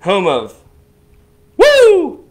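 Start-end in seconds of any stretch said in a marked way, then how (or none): 1.6-2.2 very high, loud "woo"; loud celebratory woo; whooped cheer. Real, not acted. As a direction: cut in with a big whoop. Cheers as each city is named.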